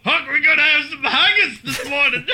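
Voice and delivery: screamed mock Scottish accent; very high, loud hammy Scot. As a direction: yell it in a badly faked Scottish accent.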